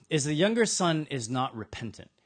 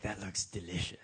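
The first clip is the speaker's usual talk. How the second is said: hungry murmur; 7 semitones lower, 10 dB quieter than usual. Retold or imitated. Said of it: "is like".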